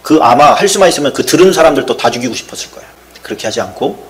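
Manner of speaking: ordinary talk, nothing marked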